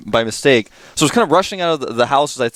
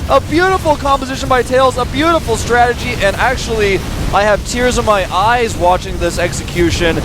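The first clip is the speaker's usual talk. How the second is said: high-pitched, thrilled battle call; excited play-by-play; 10 semitones higher than usual. Real, not acted. Caster watches the Colossi fall to Void Rays, excited play-by-play. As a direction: ride the fight with soaring excitement.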